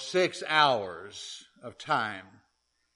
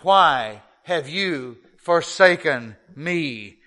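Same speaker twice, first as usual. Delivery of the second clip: anguished cry; 4 semitones higher, 7 dB louder, slower than usual. Acted. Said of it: "And he cried out".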